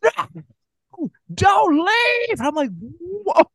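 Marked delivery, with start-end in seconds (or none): 0.0-2.4 much higher desperate shout; mocking Elle chasing Lee, shouting desperately; hammed-up melodrama. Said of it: "so overdone and so melodramatic". 2.8-3.4 much higher, wide-eyed wonder; stunned viewer commenting on the plot twist; mock astonishment. Viewer reaction.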